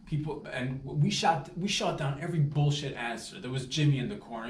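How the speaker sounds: ordinary talk, nothing marked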